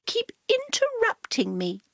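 prissy cat explaining; Cat's voice. A narrator's reading. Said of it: "said Cat".

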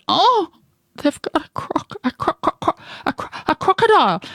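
high-pitched stutter, stuck on the word; terrified stammer. Acted. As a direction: stammer in fright, then get it out. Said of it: "He stammered".

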